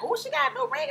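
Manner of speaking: high surprised mother voice; high mock mother remark; imitating mother. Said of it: "My mother was like".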